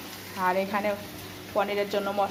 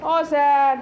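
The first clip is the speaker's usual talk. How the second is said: loud high call to a hard-of-hearing man; calling loudly; 6 semitones higher, 7 dB louder, slower than usual. Real, not acted. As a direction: raise your voice so sir can hear. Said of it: "ওই জন্য জোরে".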